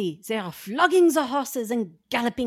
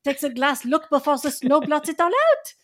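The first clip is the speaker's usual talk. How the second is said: sudden excited cry; 6 semitones higher than usual. Acted. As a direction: cry out as the riders appear. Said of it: "Then came a sudden cry".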